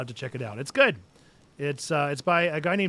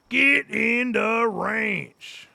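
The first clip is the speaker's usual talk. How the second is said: higher, louder, very slow Get in the ranch; stretched mock command; 8 semitones higher, 5 dB louder, much slower than usual. Gamer ordering animals into the ranch, very drawn out. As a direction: drag every word out to herd them.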